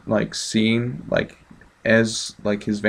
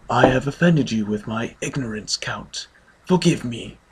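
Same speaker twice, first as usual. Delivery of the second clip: imitating a stiff, put-on English accent as Keanu Reeves; mock his wooden British accent, lightly; Keanu Reeves' accent. Reviewer mocks Keanu Reeves' Dracula accent, put-on English accent. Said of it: "let me recreate his accent for you".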